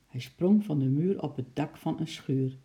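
plain reading voice

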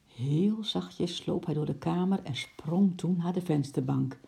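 ordinary delivery

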